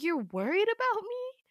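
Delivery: very high-pitched disbelieving question; incredulous echo. Acted. Girl mocking Damien's sudden worry, incredulous echo. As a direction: throw the claim back, rising steeply.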